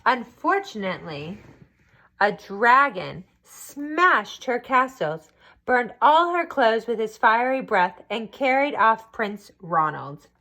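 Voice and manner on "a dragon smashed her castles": usual speaking voice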